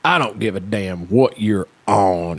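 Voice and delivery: slow, hard-edged coach voice; a hint of a gruff teacher scolding a student; stern coach. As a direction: lay it down slow like an annoyed coach. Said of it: "And he goes".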